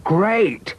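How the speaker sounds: high-pitched thrilled exclamation; eager childlike enthusiasm; very excited. A narrator's reading. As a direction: burst out like a delighted child. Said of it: "Robin was very excited".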